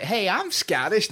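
high, slow mock American drawl; hammed-up American accent, pitched high and drawn out. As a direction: imitate a keen American claiming Scottish roots. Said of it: "American people who claim to be Scottish".

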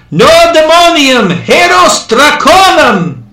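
high, loud, slow cry; shouting. A narrator's reading. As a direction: yell it over the mob. Shouting fake Latin at crowd.

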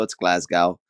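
"Glasgow" with an "ow" ending; Utah pronunciation. Shown as a demonstration. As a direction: say "Glasgow" to rhyme with "cow", the Utah way. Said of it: "Well, I'm Utah".

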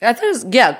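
usual speaking voice

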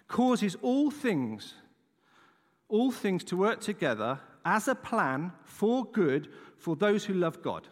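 very slow, deliberate reading; scripture reading. Acted. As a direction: draw the verse out word by word. Reads the verse slowly.

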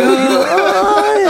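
high laughing oh; laughing whoop. Retold or imitated. Friends teasing Sean, high laughing whoop. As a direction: squeal it through giggles. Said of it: "we're just having a great time".

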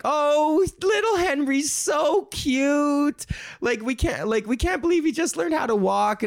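put-on high-pitched gushing over a toddler; hammed-up high adoring squeal; doting relative.

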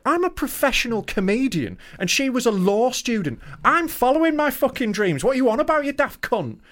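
high-pitched, worked-up comic indignation; indignant rant. Retold or imitated. Comedian answering his ex's advice, indignant rant. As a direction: rant back in disbelief at the insult.

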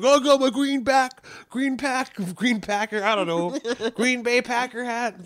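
high, fumbling comic voice; high mock heckler. Comic riffing on a heckler's hat, high fumbling voice. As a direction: stumble over the words for laughs. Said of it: "He's like".

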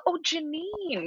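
high, soft, stretched-out imitation; mock "Oh, Janine". Co-host showing how she always says Janine's name, exaggerated high drawl. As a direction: draw it out in a high, swooping voice. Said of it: "I always say it how".

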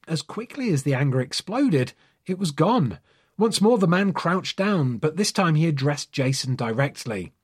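ordinary delivery

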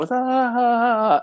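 high, loose party yelps; party whoops. Retold or imitated. Dancing with his girl at the party, high yelps. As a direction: yelp along while dancing.

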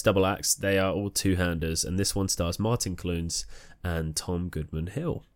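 ordinary talk, nothing marked

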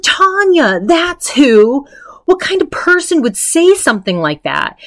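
high-pitched mock indignation; offended outrage. Retelling Adrian's offended answer about Tanya, offended outrage. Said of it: "she was so offended by that".

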